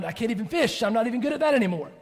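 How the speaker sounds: acted frustration, pitch climbing; exasperated. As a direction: throw up your hands in exasperation. Peter frustrated at his failure.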